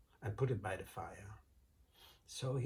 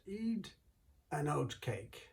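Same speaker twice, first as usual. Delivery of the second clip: high, sugary, innocent voice; sweet innocent wife; 4 semitones higher than usual. Acted. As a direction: play it sweet and harmless to fool him. Wife offering the stone cake.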